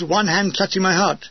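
plain reading voice

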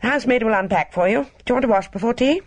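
quick, high, businesslike; brusque and practical. A narrator's reading. Housekeeper briskly telling the guest the arrangements, fast and high. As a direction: rattle it off briskly. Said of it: "brusque resumption of her practical manner".

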